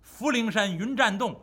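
plain reading voice

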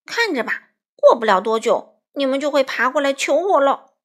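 loud, building sulky outburst; defiant threat. A narrator's reading. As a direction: shout a warning at those ignoring you. Sulking character threatens friends who ignore him.

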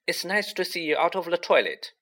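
high, artificially cheery small talk; falsely bright. A narrator's reading. Girl makes small talk with bathroom ghost, falsely bright. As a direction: keep up the pretend cheer, pitched high. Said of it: "in a falsely bright voice".

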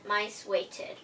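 ordinary delivery

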